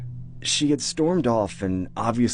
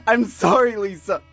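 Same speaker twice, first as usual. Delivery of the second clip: desperate pleading; 13 semitones higher than usual. Acted. Boyfriend begging Lisa on the phone not to die. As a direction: beg her over and over, close to tears. Begging girlfriend before train hits.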